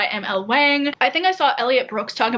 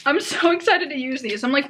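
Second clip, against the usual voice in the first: usual speaking voice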